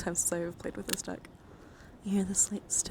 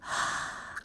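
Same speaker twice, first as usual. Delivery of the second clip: sharp intake of breath in excitement; quick, delighted gasp; excited gasp. Real, not acted. Streamer excited to show the dice, excited gasp.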